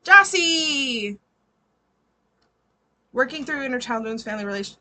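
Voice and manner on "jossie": high, loud drawn-out name call. Real, not acted. Reader calls out next client Jossie, calling out. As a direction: sing out the name, falling at the end.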